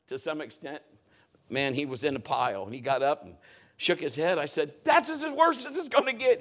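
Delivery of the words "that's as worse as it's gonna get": high, insistent call across the field; dad's encouraging call. Retold or imitated. Dad to son up from the pile, rallying call. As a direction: re-enact rallying a battered kid.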